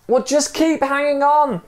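very high urgent call; high-pitched acted shout. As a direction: call it across a long drop. Calling across the drop.

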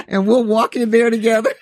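laughing while talking; giggling through the words. Real, not acted. Joking about walking in together.

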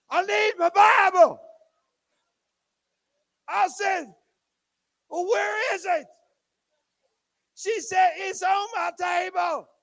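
high, slow shouted exchange; toddler voice. Retold or imitated. Little girl and Papa shout between bathroom and office, high. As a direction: call back and forth through the house.

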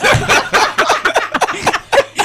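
loud, high belly laugh; loud laughter. Real, not acted. Co-host cracking up at the profesora's joke, loud laughter. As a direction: burst out laughing, long and loud.

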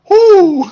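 loud, high whoop of joy; excited whoop. Retold or imitated. Singer hears his tone is liked, elated whoop. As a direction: burst out in a big whoo.

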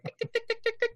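high, rapid clock ticks; little high-pitched tick-tick noises; ticking clock imitation.

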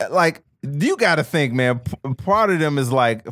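ordinary talk, nothing marked

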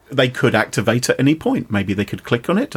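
normal talking voice

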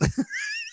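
high shrieking laugh. Real, not acted. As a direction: let out a high burst of laughter. Laughing at nickname.

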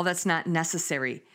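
ordinary talk, nothing marked

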